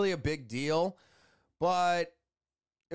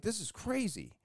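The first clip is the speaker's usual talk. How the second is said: higher, softer, awed aside; hushed amazement; 6 semitones higher, 5 dB quieter than usual. Retold or imitated. To the viewers about the fast appointment, a hushed awed aside. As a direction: let it slip out in quiet awe.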